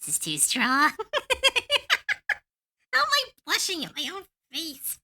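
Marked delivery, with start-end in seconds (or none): 1.0-2.4 squeaky embarrassed giggle; high giggle. Real, not acted. Drawing her own face, a squeaky embarrassed giggle.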